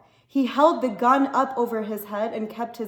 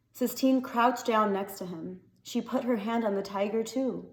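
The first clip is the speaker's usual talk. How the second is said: plain reading voice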